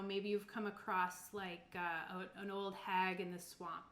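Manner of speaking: ordinary delivery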